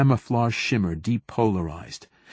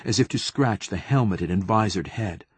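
ordinary delivery, nothing marked

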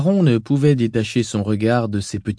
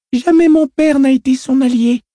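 put-on high little girl's voice; child voice; 7 dB louder than usual. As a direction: speak as an uncanny, self-assured girl. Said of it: "dit la fillette".

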